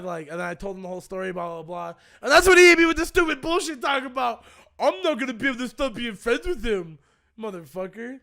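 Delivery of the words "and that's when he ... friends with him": high, loud exasperation; worked-up, mocking indignation; indignant outburst. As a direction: rant at the stupid excuse, loudly. Indignant account of friend's reply.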